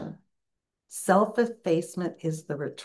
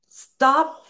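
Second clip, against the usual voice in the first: a short, high, loud "Stop"; sharp stop; 9 semitones higher, 8 dB louder than usual. Retold or imitated. Wife cutting off Hadayat with a loud high "Stop". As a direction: cut them off with a loud "Stop". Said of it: "Aziza was like".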